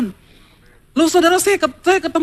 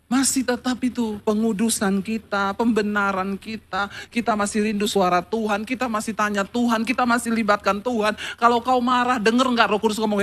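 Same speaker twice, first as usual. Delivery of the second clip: hushed, intimate preaching, much softer; soft altar call; 5 semitones lower, 7 dB quieter than usual. Acted. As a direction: draw them in gently toward repentance.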